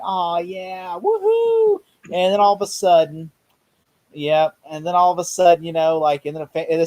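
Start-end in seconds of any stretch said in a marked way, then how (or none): 0.1-1.8 imitated excited cheering. Imitating the Comic-Con crowd, excited cheer. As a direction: whoop like a thrilled fan. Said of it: "everybody's excited 'cause they're like".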